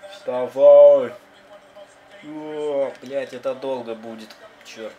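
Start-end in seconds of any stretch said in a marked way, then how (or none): 0.1-1.1 loud yell to get up; shouting. Real, not acted. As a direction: yell it at the screen. Urges again, much louder.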